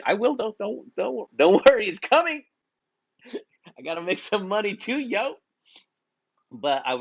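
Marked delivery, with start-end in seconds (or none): 0.0-5.3 high-pitched, playful mock reassurance; joking aside. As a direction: tease them in a squeaky-high comic voice. Jokingly admits a sales pitch coming.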